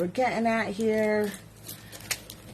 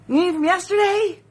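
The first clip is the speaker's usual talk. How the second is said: YESTERDAY asked much higher, incredulous; mock disbelief at yesterday; 9 semitones higher, 4 dB louder than usual. Shown as a demonstration. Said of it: "repeated with bigger pitch".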